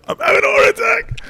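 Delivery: very high, strained cry of pain; strained panic. Retold or imitated. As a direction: squeeze it out as if in agony. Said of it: "I go".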